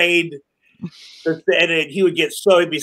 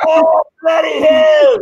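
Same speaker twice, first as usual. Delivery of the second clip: much higher, louder mock British yelp; British pain yell; 11 semitones higher, 7 dB louder, slower than usual. Hit in the nose on set, British yelp.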